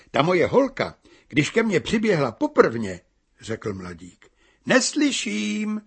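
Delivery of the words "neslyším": slow, higher shout against the wind; bride shouting. A narrator's reading. Bride shouts over the wind that she can't hear. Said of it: "vykřikla nevěsta".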